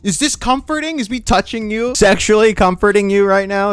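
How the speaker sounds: high, incredulous questioning; mocking disbelief. Mocking the claimed comforting as absurd.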